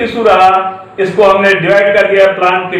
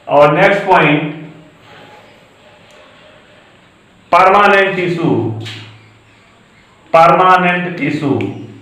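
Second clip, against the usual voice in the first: very slow, word-by-word classroom English; teacher reading out notes very slowly; slow dictation; much slower than usual. Acted. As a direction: dictate each phrase slowly for students to copy.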